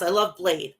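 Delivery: usual speaking voice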